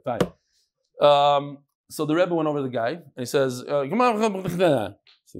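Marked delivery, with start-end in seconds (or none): 3.9-4.9 mock hurried davening, words run together; rushed mumbled prayer. Rebbe mocking the fast davener, rushed mumble. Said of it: "That's what your Shemona Esrei sounded like".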